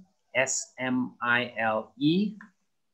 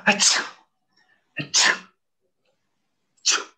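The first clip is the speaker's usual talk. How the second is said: acted achoo sneeze; mock sneeze; much faster than usual. Shown as a demonstration. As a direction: fake a sneeze. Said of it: "maybe you will do this".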